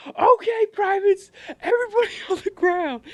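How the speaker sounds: high, loud imitated order with laughter breaking in; drill sergeant command. Drill sergeant sending privates to the ground, yelling. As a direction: yell the order while cracking up.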